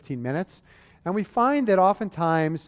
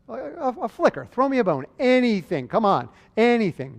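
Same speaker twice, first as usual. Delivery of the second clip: higher-pitched, exasperated coaxing; mock pleading; 6 semitones higher than usual. Doctor urging a big patient to move his shoulder, acted pleading. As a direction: beg for the tiniest movement.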